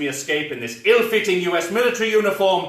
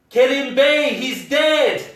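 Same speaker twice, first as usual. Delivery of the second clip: anguished shout; 8 semitones higher, 5 dB louder, slower than usual. Acted. Shouting news of death.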